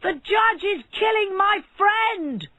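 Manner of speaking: slow, loud, high alarmed shout; frantic shout of alarm. A narrator's reading. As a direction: yell it to the whole street. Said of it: "began to shout".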